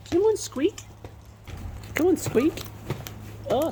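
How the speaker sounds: very high coaxing call; high-pitched pet call; calling a bird. Real, not acted. Calling bird named Squeak.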